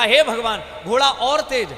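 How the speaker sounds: "हे भगवान" called out loud, urging the horse on. Acted. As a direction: call "हे भगवान" out loudly to spur the horse. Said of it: "फिर इसने कहा".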